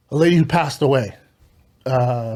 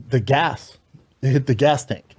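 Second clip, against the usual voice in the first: usual speaking voice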